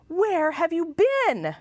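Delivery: high, alarmed question; gasping alarm. Acted. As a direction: ask it in a startled rush. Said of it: "Eleanor's mother gasped and asked".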